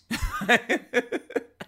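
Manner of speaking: high laugh at oneself; self-conscious laugh. Real, not acted. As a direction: laugh at your own silly fear. Laughs at own childhood fear.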